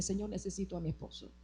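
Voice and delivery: very soft, quick plea to God; hushed retelling of a tearful plea; retold tearful prayer. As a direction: murmur it as a remembered pillow prayer. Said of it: "lloré al Padre en mi almohada".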